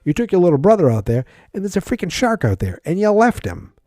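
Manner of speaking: loud, high, fast indignant tirade; angry rant. Real, not acted. Reviewer scolding the movie character, loud and outraged. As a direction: tear into him, outraged.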